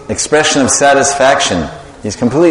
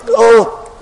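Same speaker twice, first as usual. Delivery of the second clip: disgusted grimace groan; 6 semitones higher, much slower than usual. Acted. Krishna groaning at the bitter sweet to Radharani. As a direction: act out tasting something bitter. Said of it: "and he goes".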